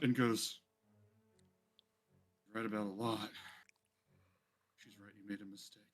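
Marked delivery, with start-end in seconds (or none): 2.5-5.8 low, soft deadly words; quiet menace. Acted. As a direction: murmur it right before the kill. Bulbug before killing.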